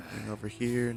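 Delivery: ordinary delivery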